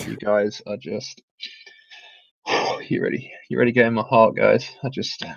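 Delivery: mock raw emotion. Podcaster told to mean it, hammed-up heartfelt. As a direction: sigh and falter as if overcome. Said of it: "Pure raw emotion from [name]".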